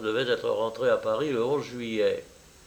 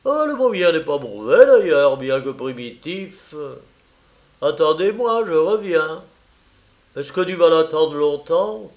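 mocking; 3 semitones higher, 8 dB louder than usual. A narrator's reading. Paul teases his friend about waiting for her, mocking. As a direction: taunt him with bursts of mimicry. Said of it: "me raillait".

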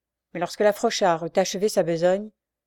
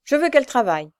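high, loud order; irate insistence; furious demand; 6 semitones higher, 6 dB louder, faster than usual. A narrator's reading. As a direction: demand it angrily. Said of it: "gronda la mendiante hors d'elle".

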